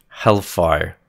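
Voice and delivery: ordinary delivery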